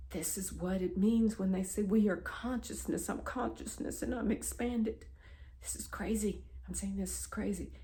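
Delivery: soft, amazed murmur; reliving quiet astonishment; hushed awe. Retold or imitated. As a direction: marvel under the breath. Awed realisation, volume dropped.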